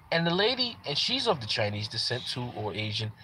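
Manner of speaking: usual speaking voice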